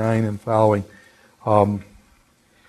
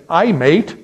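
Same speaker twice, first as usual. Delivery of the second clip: mock British accent, pitched higher and louder; 6 semitones higher, 5 dB louder than usual. Imitating a visitor greeting New Englanders, mock British accent. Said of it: "with a British accent".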